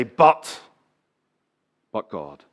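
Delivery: "But," 一顿，再轻轻落下 "but God"; dramatic pause. Retold or imitated. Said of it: "then he would pause and then he'd say".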